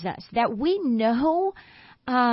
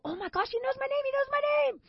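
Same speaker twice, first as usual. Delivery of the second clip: very high, fast, hushed squeal; giddy gushing; 5 dB quieter, much faster than usual. Retold or imitated. Schoolgirl squealing to herself after her crush greets her, giddy. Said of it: "you walk away and go".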